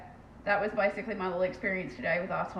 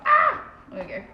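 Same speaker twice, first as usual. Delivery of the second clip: surprised relief; 8 dB louder than usual. Real, not acted. Camera finally focuses.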